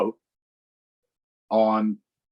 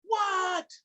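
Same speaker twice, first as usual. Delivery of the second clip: high froggy voice. A narrator's reading. Froggy answers mother.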